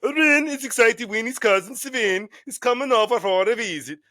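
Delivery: lightly voiced Swedish chef gibberish. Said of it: "I'm gonna do it also as a Swedish chef".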